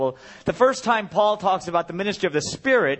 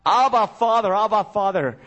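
desperate cry; 4 semitones higher than usual. Retold or imitated. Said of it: "at Gethsemane crying out".

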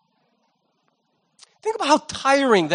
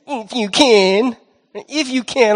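sarcastic; slower than usual. Retold or imitated. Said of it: "I'd say it sarcastic".

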